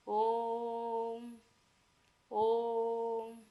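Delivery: long held vowel, like calling someone far off; drawn-out call. Shown as a demonstration. Teacher demonstrating a long vowel call to a distant person. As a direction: stretch the vowel as if calling from afar. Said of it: "किसी को दूर से बुलाने के लिए".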